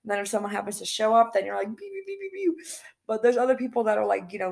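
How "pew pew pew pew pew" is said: high, quick mouth-made gun sounds; pew-pew gunfire noises. Retold or imitated. Player mimicking a shootout in the game, high pew-pew noises.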